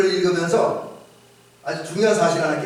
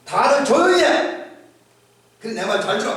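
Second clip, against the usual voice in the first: tiger king's shout; 6 semitones higher than usual. Retold or imitated. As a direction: bellow for silence like a king. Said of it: "소리칩니다".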